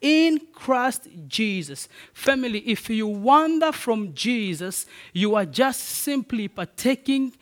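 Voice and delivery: stretched, ringing pulpit climax; full-force preaching. Acted. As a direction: drive the scripture home to the family.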